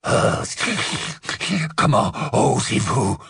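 rough werewolf growl. Acted. Paralysed werewolf protests to the witch, growling. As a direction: rasp out the outrage. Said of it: "gronda-t-il".

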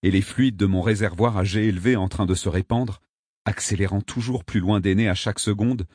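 ordinary delivery

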